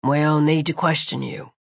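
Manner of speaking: low-pitched officer's reply; policeman voice. A narrator's reading. Policeman answers the apprentice.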